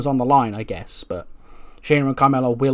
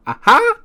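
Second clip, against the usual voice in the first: a loud whooping "Aha!" pitched very high; mock battle cry; 7 dB louder than usual. Ricochet taunting AJ Styles with his loud high cry. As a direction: yelp it like a cocky high-flyer. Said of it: "just stop making that noise".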